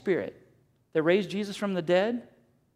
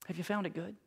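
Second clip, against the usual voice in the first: asked again, much softer and hushed; soft repeat; 12 dB quieter, faster than usual. Acted. Pastor repeating his question to the congregation, much softer. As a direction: drop it almost to a murmur, letting it sink in.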